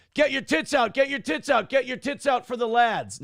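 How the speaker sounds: high, chanted catcall. Acted. Reads out suggested British catcall.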